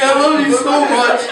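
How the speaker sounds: put-on loud, high drunken declaration; mock drunken gushing. Retelling a drunk friend's gratitude, loudly gushing.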